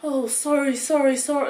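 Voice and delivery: imitating a guard who suddenly realises his mistake; flustered guard apology. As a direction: mimic the embarrassed security guard backing off. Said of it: "he was like".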